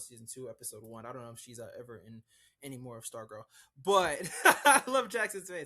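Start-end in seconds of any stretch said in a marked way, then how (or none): 3.8-5.7 high, loud, laughing aside. Real, not acted. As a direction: burst into a loud laugh mid-sentence. Cracks up at co-host's face.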